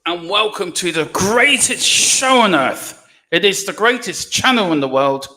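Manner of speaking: loud, hammed-up ringmaster welcome, slowing down at the end; showman announcer. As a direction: belt it out like a circus ringmaster opening the show. Mock grand show opening.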